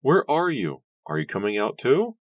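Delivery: high, loud, pleading questions; desperate shout. Acted. Nora searches for Daniel from outside, high shouting. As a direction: call out, searching for an answer. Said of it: "Nora shouted".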